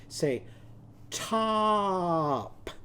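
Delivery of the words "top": "top" drawn out long on a raised, held vowel; "top" with a very long, higher vowel; slow-motion word. Shown as a demonstration. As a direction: stretch "top" slowly so every sound can be heard. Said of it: "say the word slowly and listen for the sounds".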